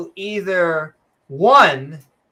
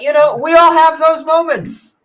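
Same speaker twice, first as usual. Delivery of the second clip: higher and louder, a hammed-up sigh of sympathy; mock sympathetic; 9 semitones higher, 7 dB louder than usual. Host consoling the audience about Elon's bad buys, hammed-up. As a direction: play it as theatrical consolation.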